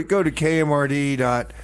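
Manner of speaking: ordinary talk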